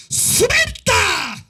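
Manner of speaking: high, loud command to let go; commanding shout. Real, not acted. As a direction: bark the order at the demon.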